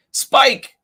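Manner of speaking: high, loud calling shout. Acted. Charlie calls a guessed name to the dog, high shout. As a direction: call the dog across the street. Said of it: "shouted Charlie".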